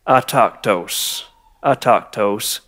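slow, low "autochthos", dropping in scorn; with disdain. Shown as a demonstration. Pastor condemning the idle faction, with disdain. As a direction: let the word fall with contempt. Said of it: "say it with a little bit of disdain".

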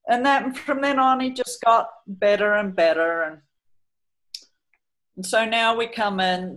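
usual speaking voice

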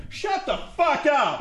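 high, strained mock yell, held back. Fed-up moviegoer turning on talkers, high strained mock yell. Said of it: "you turn around and go".

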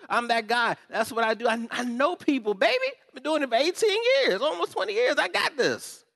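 high, loud boastful mock self-assurance; cocky ministry guy. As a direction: puff up like the know-it-all ministry guy. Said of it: "for all of you all that say".

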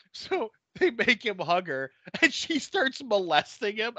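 ordinary talk, nothing marked

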